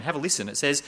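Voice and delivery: normal talking voice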